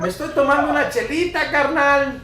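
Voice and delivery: exaggerated Mexican compadre voice; Mexican accent. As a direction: swagger it like a Mexican buddy with a beer. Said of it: "they talk like this".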